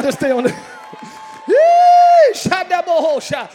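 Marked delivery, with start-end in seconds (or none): 1.5-3.5 high, loud ecstatic cries; spirit-filled shouting; ecstatic tongues shout. Real, not acted. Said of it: "I'm sorry".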